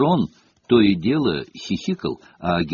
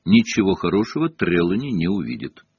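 usual speaking voice

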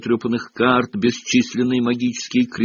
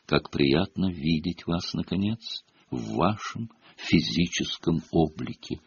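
soft, distant otherworldly voice; muffled unearthly voice; 5 semitones lower, 9 dB quieter than usual. A narrator's reading. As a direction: speak like a faint voice from nowhere. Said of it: "приглушённый, почти неземной голос".

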